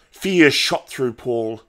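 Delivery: ordinary delivery, nothing marked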